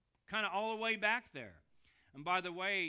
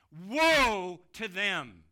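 slow, much louder cry of woe; emphatic cry; 11 dB louder, much slower than usual. Shown as a demonstration. Preacher crying out a warning to the congregation, loud and slow. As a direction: draw out Woe like a lament. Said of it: "It is emphatic in the Greek".

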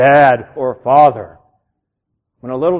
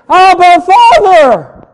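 Abba Father screamed, higher and much louder; screaming out; 9 semitones higher, 10 dB louder, much slower than usual. Shown as a demonstration. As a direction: cry out Father like a child shouting for its daddy. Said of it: "I hope I can get a little bit animated".

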